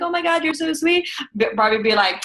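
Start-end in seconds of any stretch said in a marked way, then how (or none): 0.0-1.1 high, sugary mock gush; hammed-up sweet delight; imitated gushing. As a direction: gush it, pitched well up. Brigida gushing in retold exchange.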